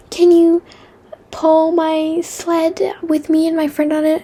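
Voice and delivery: put-on high, slow childlike plea; imitating a little kid. Kindergartner begging her to pull the sled, high child voice. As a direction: mimic a small kid asking sweetly. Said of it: "they were like".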